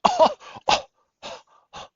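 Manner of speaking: high, squeaky mock-offended "oh, oh"; mock offended gasps. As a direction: clutch your pearls with fake shocked gasps. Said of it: "And then they might say".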